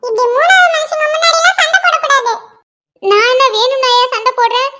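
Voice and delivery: ordinary delivery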